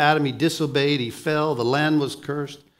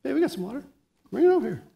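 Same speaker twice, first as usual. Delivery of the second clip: higher, bright call to a friend; excited friend; 10 semitones higher, much faster than usual. Pretend. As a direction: call over to the friends, thrilled. Imagined friend calls out gladly.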